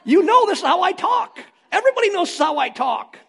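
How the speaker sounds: high-pitched, loud self-defense; yelling. Acted. Husband defending his talk to upset wife, yelling. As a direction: yell it while insisting it's normal talk. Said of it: "you're yelling when you're talking".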